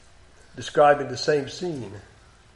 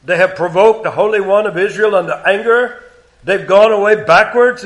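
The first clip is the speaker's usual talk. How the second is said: passionate scripture reading; 4 semitones higher, 6 dB louder than usual. Acted. Preacher voicing passionate God against the sinful nation. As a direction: pour God's passion into it. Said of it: "notice how passionate God is".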